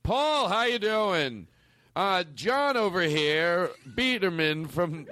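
high, slow, hearty phone-message voice; stretched-out mock businessman greeting; Shittens guy. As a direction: play a sponsor leaving a drawn-out, high-pitched message. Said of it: "I have to be the Shittens guy".

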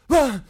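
high, loud yelp of fright; hammed-up startled gasp; mock waking scream. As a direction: cry out as if jolted from a nightmare. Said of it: "He still wakes up and just like".

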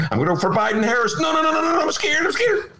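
high, fast panicked yelp; hammed-up terror at the ballot; cohost's hand pretending to panic in the booth, high mock shouts. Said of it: "here's Dennis's hand in the voting booth".

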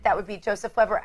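usual speaking voice

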